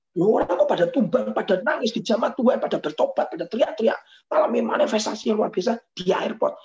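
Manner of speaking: high, loud astonished voice; baffled officials crying out; bewildered staff. Retold or imitated. Voicing bewildered airport staff. (petugas bandara yang heran dengan suara tinggi)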